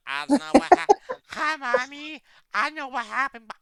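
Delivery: put-on high, loud, dazed little kid; like waking up knocked silly, calling for mommy; high loud childlike daze. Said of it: "wake up in the third grade".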